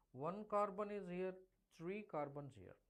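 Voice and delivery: ordinary delivery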